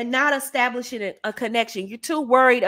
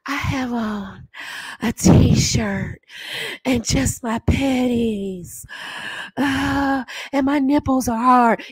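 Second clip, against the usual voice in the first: phone sex voice; slower than usual. Shown as a demonstration. Coach demonstrating phone sex voice for the ladies. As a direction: breathe it out slowly to tempt him. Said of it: "What do you have on?".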